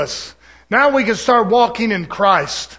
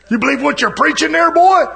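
teasing wife; 8 semitones higher, 4 dB louder than usual. Retold or imitated. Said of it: "she's over there".

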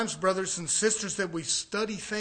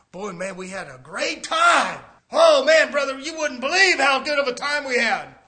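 shouting and much higher, a braggart's glee; boasting shout; 6 semitones higher, 10 dB louder than usual. Retold or imitated. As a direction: yell it like a man bragging of his good times. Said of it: "Should I go into some examples?".

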